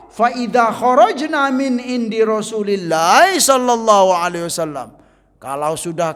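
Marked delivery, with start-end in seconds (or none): 0.1-4.9 Arabic recitation. Acted. Recites Arabic hadith text.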